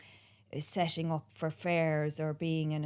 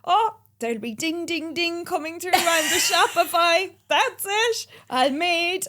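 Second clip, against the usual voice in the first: high, loud, gushing mock dreamer; naive excitement; 9 semitones higher, 9 dB louder than usual. To the host, mocking her naive past self with excitement. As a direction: play your giddy past self. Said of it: "I really thought".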